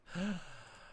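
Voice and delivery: startled gasp. Real, not acted. Startled by mistake in game.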